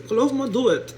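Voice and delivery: usual speaking voice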